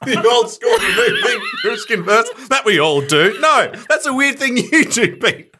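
high, laughing tease. Real, not acted. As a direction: laugh while teasing him. Teasing Pete, laughing hard.

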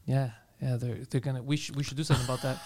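low, soft, uninterested mumble; imitating an actor who doesn't care, quiet and flat; bored monotone. Mocking a bored Billy, low and soft. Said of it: "he doesn't wanna be there".

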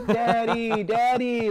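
put-on mild toddler voice reaching for dad; excited toddler. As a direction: call for daddy like a happy toddler. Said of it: "reaching his arms out".

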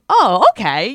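loud, exaggerated reply of sudden understanding; mock realization. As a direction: act out a big, loud acknowledgment. Acting out her surprised reaction.

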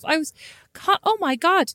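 ordinary talk, nothing marked

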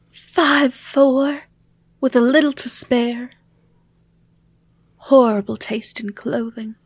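usual speaking voice

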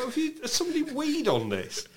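high, scandalised teller voice; mock shocked teller. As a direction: play a shocked cashier. Voicing bank tellers.

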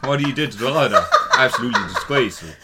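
loud, hammed-up bad Irish accent, laughing through it; outraged listener in a mock Irish accent. Host plays offended listener quitting over Cromwell joke, bad Irish accent. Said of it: "you can do a bad Irish accent".

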